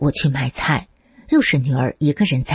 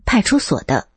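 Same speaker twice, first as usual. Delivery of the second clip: cocky official reply, pitched up; a touch of swagger, higher; 嚣张 arrogant; 6 semitones higher than usual. A narrator's reading. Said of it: "他们嚣张地说". (略显嚣张，音调偏高)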